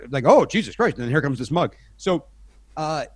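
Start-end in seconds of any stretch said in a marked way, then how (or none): 0.2-1.0 exasperated. Retold or imitated. Exasperated crew reaction.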